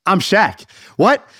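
acted double take, pitch jumping up; startled disbelief. [name] reading about himself in the newspaper, high surprised exclamation. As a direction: leap up high on the realization. Acts [name]'s startled reaction.

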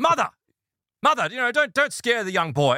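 high-pitched mock reprimand; mock scolding. Son tells his mother to stop scaring the buyer, high mock scolding. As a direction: imitate an embarrassed son telling mother off.

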